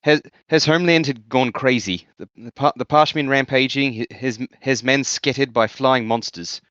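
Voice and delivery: attempted New Zealand accent, slightly overcompensated; New Zealand accent drifting towards South African. Shown as a demonstration. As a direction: read slowly in a careful New Zealand accent. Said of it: "I think that sounds too Sou".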